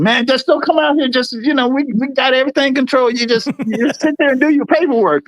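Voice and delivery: retold anxious reassurance; placating plea. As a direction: talk someone into staying put. Retold pleading with smelly officer.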